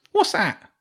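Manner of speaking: high, puzzled little rat; rat soldier. Acted. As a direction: pipe up confused, very high. Rat soldiers chatter in surprise.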